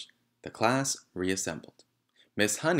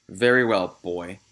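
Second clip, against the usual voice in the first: stern command; 7 dB louder than usual. A narrator's reading. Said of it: "she said".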